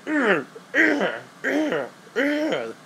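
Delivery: high, strained mock grunting; imitated grunts. Reviewer imitates the attacker in the film, exaggerated high grunts. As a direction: grunt in short repeated bursts, hammed up. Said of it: "and this dude's like".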